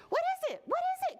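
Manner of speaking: very high, urgent storybook cry; high-pitched alarmed questioning. A narrator's reading. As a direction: cry it out as a rushing spider. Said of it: "cried Spider".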